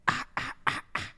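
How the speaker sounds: normal talking voice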